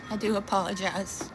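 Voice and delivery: real shaky voice holding back tears; shaking voice. Real, not acted. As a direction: voice trembling with grief. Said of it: "I'm sorry my voice is shaking".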